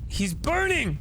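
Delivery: high alarmed shout; horrified cry. Real, not acted. Player seeing the kid on fire, horrified.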